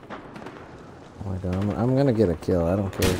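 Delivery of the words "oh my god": breathless whisper, very soft and slow; whispered awe. Real, not acted. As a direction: hush it out in disbelief. Sudden shotgun excitement.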